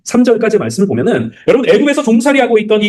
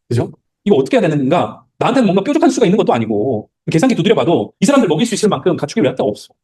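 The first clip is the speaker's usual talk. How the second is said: usual speaking voice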